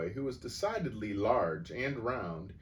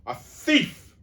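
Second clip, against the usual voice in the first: much higher, much louder scream; 12 dB louder than usual. A narrator's reading. Headmistress accusing the boy, screaming. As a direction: shriek the accusation. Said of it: "the Crunchbull screamed".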